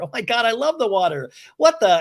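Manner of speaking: high-pitched eager imitation; mock excited exclamation. Recapper imitating onlookers heading for the water, excitedly high.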